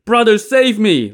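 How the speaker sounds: high, loud cry for help; shouting. A narrator's reading. Li Kui begs his brother for rescue, shouting. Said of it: "Li Kui shouted".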